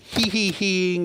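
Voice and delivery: drawn-out, lightly mocking villain voice; a hint of cartoon villain glee; mock villain snicker. Telling the audience how Snidely gloats, mock villain snicker.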